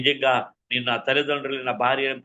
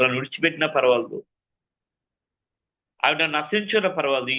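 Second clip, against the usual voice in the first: usual speaking voice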